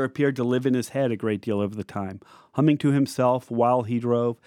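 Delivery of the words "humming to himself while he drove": ordinary delivery, nothing marked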